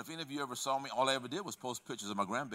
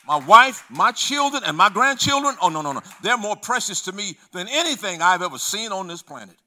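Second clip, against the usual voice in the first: loud, high, defiant sermon voice; preaching at full force; 6 semitones higher, 13 dB louder than usual. Acted. Preacher warning about the old self, loud and high. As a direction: stand your ground for your family.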